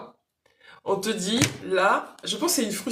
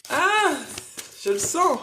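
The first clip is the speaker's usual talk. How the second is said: excited; 6 semitones higher, 3 dB louder than usual. Real, not acted. Feeling the channeled message arrive.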